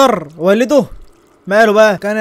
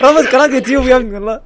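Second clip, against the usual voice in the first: higher, words breaking into laughter; laughing in disbelief; 4 semitones higher, 3 dB louder, faster than usual. Real, not acted. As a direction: laugh through the words, incredulous. يضحك على رابط قناة يوتيوب. (يضحك وهو مش مصدق)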